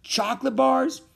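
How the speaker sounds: high, loud incredulous question; exasperated high-pitched query; angry disbelief. A narrator's reading. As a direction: squawk it in disbelief, rising. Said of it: "Lefty said in an angry way".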